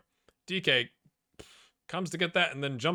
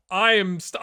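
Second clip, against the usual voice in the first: ordinary talk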